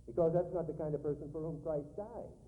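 loud, rising sermon climax; preaching at full force. Acted. Preacher rousing the congregation about Christ's death, loud and forceful. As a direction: drive it home to the congregation.

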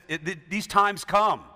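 ordinary talk

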